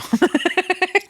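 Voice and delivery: high-pitched laugh; short burst of high laughter. Real, not acted. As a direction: laugh at your own joke. Joking about her own look.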